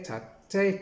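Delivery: ordinary delivery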